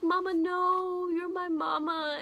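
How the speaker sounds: put-on a young boy crying for his mama, high and drawn out; pleading child. Reviewer voices a boy pulled from his mother, pleading child. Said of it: "he was like".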